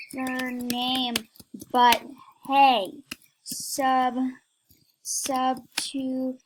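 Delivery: halting, one chunk at a time; word-by-word dictation. Real, not acted. Dictating a typed message word by word.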